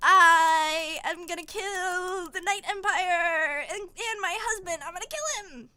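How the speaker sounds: hammed-up murderous resolve; vengeful vow. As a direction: declare it like a melodramatic heroine. Heroine vows to kill, acted.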